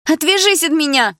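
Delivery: high, loud furious shriek. A narrator's reading. Blonde fights off her ex, high furious shriek. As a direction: scream him off you.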